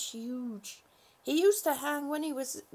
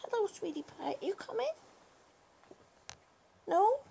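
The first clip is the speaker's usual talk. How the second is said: coaxing pet talk; 7 semitones higher, faster than usual. Real, not acted. Coaxing her cat to come.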